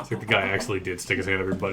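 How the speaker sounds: ordinary talk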